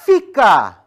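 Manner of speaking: loud sharp stay command. Real, not acted. Trainer ordering the dog to stay, loud. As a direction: hold the dog in place with your voice.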